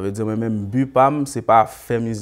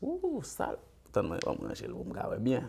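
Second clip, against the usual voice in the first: mock lovestruck; 6 semitones higher, 7 dB quieter than usual. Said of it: "ou amoureux".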